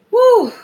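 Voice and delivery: high, loud exhale after laughing; breathless whew. Real, not acted. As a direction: blow out the giggles.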